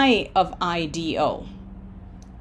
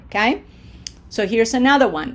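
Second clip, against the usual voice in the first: usual speaking voice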